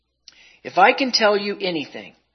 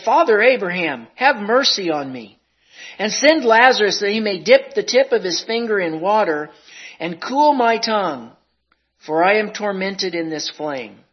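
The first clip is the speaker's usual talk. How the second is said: tormented cry; 4 semitones higher, 6 dB louder than usual. Acted. Rich man crying from torment.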